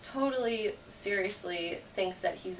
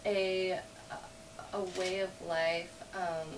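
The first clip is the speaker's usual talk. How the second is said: ordinary talk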